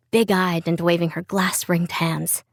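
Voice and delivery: ordinary delivery, nothing marked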